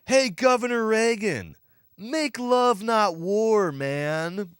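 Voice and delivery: high, loud put-on hippie; hippie heckler voice. Heckler calling to Reagan, hammed-up hippie. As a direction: call out like a goofy hippie protester. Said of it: "He says".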